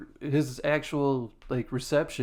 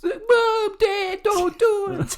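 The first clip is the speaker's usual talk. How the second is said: put-on much higher, loud, slow childish wail; mock crying kids; 6 dB louder, much slower than usual. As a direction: plead like a panicked little kid.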